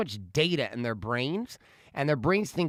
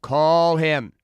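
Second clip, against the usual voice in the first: loud insistent; 2 semitones higher, 9 dB louder than usual. Retold or imitated. Dad insisting again.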